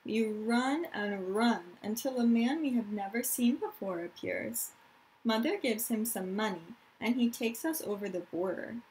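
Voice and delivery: put-on reading aloud to young children; story narration. A narrator's reading. Teacher reads a refugee story to her class, storytelling voice. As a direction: tell the story gently to the class.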